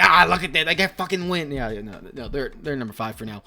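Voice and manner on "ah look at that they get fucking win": loud, higher-pitched mock cheer; mock excited outburst. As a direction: burst out like an overexcited fan. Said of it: "I'm gonna be like".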